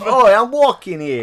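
high, slow mock New Yorker; New York accent. Imitating a pedestrian in New York, mock New York accent. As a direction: stretch out a cartoon New York complaint. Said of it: "in a New York".

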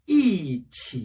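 drawn-out falling "이" and dipping "치"; fourth tone then third. Shown as a demonstration. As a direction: drop on "이", then dip through "치". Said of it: "삼 성이 왔을 때예요".

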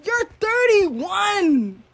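high, very drawn-out disbelief; incredulous outburst. Real, not acted. Telling off an older rival, drawn-out incredulous wail. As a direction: stretch each key word high and long.

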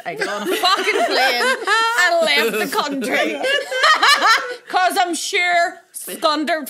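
laughing at her own dramatic flight. Real, not acted. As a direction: crack up telling the escape. Comic escape story.

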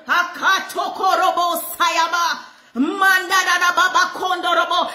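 fervent tongues, shouted out; speaking in tongues. Real, not acted. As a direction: pour out tongues in worship. Praying in tongues while preaching.

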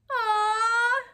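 high, loud squealing aw; delighted aw. Acted. Delighted exclamation.

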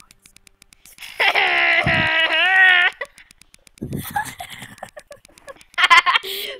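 very high squeaky unvoiced cackle; high wheezy put-on laughter; laughing in the put-on voice. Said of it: "I'm supposed to laugh in this voice".

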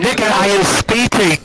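loud, slow, emphatic yell; angry shout. Real, not acted. Talked-over player to interrupting friend, angry shout.

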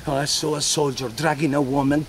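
usual speaking voice

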